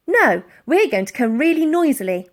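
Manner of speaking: defiant. A narrator's reading. Defiant Elsie.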